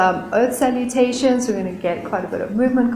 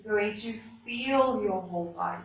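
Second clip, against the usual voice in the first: soft, unhurried yoga relaxation voice; guided meditation; 2 semitones lower, 13 dB quieter than usual. Real, not acted. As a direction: ease them into stillness, much softer, with long pauses.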